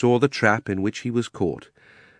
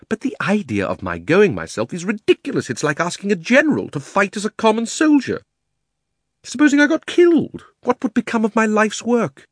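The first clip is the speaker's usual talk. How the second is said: high, self-important uncle, climbing higher; indignant Uncle Andrew; 13 semitones higher, 5 dB louder than usual. Acted. Uncle refuses to go himself, indignant. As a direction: protest your own importance, ever higher.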